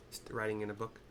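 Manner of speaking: ordinary talk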